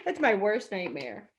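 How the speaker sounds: ordinary delivery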